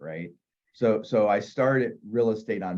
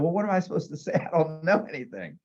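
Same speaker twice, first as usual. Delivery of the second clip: higher, anxious and unsure; nervous question; 7 semitones higher, faster than usual. Retold or imitated. New agent asking the secretary what to say, nervous. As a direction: ask like a lost beginner.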